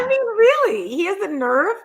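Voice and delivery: high, incredulous questioning; indignant disbelief. Real, not acted. Woman reacting to a commentator mocking someone's looks, indignant. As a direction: pitch leaps up in real disbelief.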